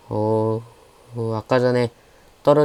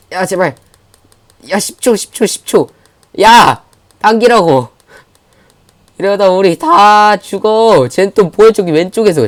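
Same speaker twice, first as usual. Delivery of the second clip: high, very loud desperate yells; frantic shouting; 8 semitones higher, 8 dB louder, slower than usual. Real, not acted. Streamer urging team to pull in final seconds, frantic shouting. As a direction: scream at the team in real panic. Last seconds of losing contest.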